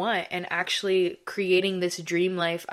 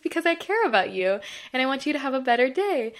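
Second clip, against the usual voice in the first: higher, quick retold reassurance; caring manager; 6 semitones higher, faster than usual. Manager cheering up someone having a bad day, higher-pitched caring. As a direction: lightly voice a sweet manager, pitched up and hurried. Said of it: "And she's like".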